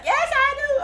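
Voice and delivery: very high, loud mock wedding vow; loud groom's vow. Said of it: "Be like".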